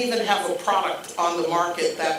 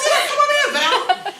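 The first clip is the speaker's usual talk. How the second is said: laughing boss; 5 dB louder than usual. Retold or imitated. Boss voiced, high laughing.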